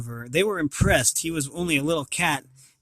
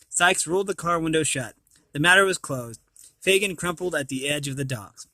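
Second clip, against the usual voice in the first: usual speaking voice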